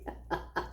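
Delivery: soft giggle trailing into chuckles; quiet wordless laughing; real laugh. Real, not acted. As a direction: giggle at your own story. Laughs at own story.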